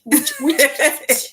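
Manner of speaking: slow, drawn out through laughter; chuckling punchline; laughing. Real, not acted. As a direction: laugh the word out slowly. Joking about jealousy as witchcraft.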